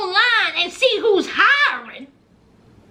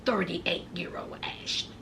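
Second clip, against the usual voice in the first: whisper; 12 semitones lower, 13 dB quieter than usual. Real, not acted. Snide whispered aside.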